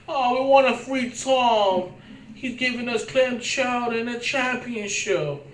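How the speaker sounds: high, slow mock Boston voice; Boston accent. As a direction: drawl it like a Red Sox fan. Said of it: "guess that's a good Boston accent".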